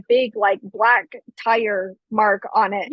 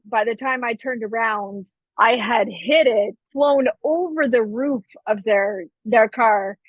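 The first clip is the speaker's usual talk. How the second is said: usual speaking voice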